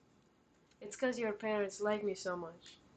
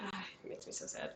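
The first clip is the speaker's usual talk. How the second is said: whispered sadness; 13 dB quieter, faster than usual. Real, not acted. Sad film memory.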